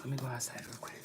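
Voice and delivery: soft unvoiced whisper; hushed aside. Real, not acted. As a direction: whisper so the cat isn't disturbed.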